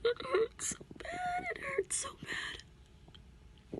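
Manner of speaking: high, thin sobbed words, dragging out; real crying. Real, not acted. Woman hurting over her relationship breaks down on camera, sobbing. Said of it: "and crying".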